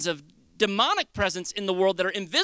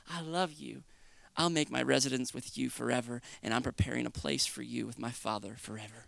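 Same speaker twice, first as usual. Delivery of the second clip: soft, low, loving answer; tender reassurance; 8 semitones lower, 10 dB quieter than usual. Acted. Jesus answering a repentant sinner, tender and hushed. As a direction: answer gently and low, unhurried.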